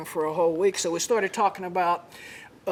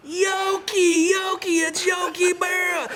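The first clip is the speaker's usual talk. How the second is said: starstruck shouting; 10 semitones higher, slower than usual. Retold or imitated. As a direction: shout the name like starstruck fans. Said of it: "and they go".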